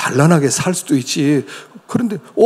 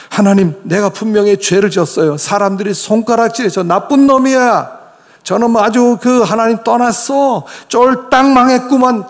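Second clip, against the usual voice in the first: mock accusers; 6 semitones higher than usual. Repentant voicing mocking accusers.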